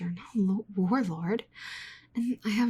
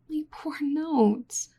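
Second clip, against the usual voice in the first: slow, higher lament; mournful sigh; 6 semitones higher, much slower than usual. Acted. Researcher mourning lost notes to the adventurer, slow. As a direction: drag it out like a eulogy. Sighs over ruined notes.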